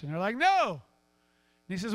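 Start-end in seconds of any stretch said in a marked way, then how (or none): 0.4-0.7 calling back. Acted. Fishermen shout back their answer.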